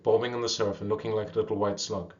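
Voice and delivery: ordinary delivery, nothing marked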